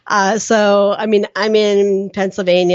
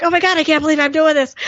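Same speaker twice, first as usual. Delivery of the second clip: high, fast, giddy disbelief; hysterical; 10 semitones higher, faster than usual. Retold or imitated. [name] retelling her nerves before the lunch date, fast high flustered rush.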